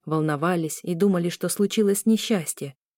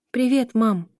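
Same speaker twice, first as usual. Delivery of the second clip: faltering greeting; 5 semitones higher, 3 dB louder, slower than usual. A narrator's reading. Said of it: "поздоровалась девушка дрогнувшим голосом".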